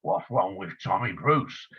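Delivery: low, rough-edged Cockney voice for Tommy; Cockney accent. Retold or imitated. Tommy answering the producer about his name, in Cockney accent. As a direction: drop into Tommy's Cockney and ask it straight. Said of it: "in his Cockney accent, which I can't do".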